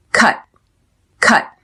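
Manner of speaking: "Cut" cut off on an unreleased T; stop T. Shown as a demonstration. Teacher showing learners the stop T, "Cut" with unreleased T. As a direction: stop the air at the end of "Cut", no T release. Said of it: "There is a stop T".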